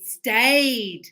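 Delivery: "Stayed" drawn out to a clear D; modelled -ed ending. Shown as a demonstration. Teacher correcting a student's "stay", stretched clear ending.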